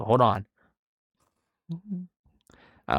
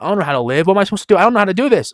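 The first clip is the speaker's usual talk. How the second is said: imitating his own panicked self, a bit higher and louder; mock panic; 6 semitones higher, 5 dB louder, much faster than usual. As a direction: act out freaking about the future. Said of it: "I'm freaking out".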